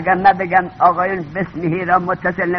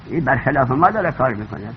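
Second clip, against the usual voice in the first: usual speaking voice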